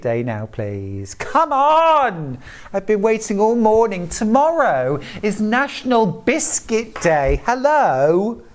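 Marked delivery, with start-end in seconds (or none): 1.1-8.4 excited. Real, not acted. Excited about Biscuit Day.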